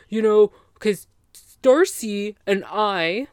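loud, mocking big reveal; mock boastful ex. Ex tells friends his big secret, mock boastful. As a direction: announce the secret with exaggerated importance.

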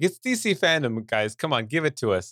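high-pitched mock-mad fan plea; retold exasperation. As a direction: plead like a frustrated fan, half in fun. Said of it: "I was so mad".

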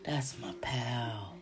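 slow hushed whisper, crushed; heartbroken. Retold or imitated. Jubu about his buddy, heartbroken whisper. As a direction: whisper it like losing a friend. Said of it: "He was heartbroken".

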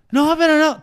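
put-on high, loud childish wail; loud whiny kid protest. The kid protests as the teacher sends him home, a high loud wail. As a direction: protest like a kid who's in trouble.

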